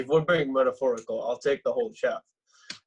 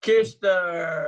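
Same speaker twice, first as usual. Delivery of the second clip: loud, high greeting shout; calling out; 6 semitones higher, 6 dB louder than usual. Acted. Drunk friend shouting a greeting as Kish enters. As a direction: call her name across the room.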